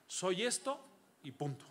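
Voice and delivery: ordinary talk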